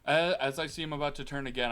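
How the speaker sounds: ordinary talk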